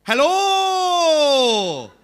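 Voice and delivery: loud, high, stretched Welsh-Indian lilt; Welsh-Indian accent. Shown as a demonstration. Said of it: "are perfectly interchangeable".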